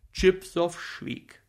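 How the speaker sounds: ordinary delivery, nothing marked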